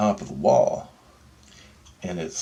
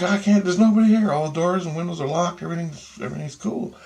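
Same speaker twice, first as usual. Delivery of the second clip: cousin reporting; 8 semitones higher than usual. Retold or imitated.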